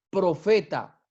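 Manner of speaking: usual speaking voice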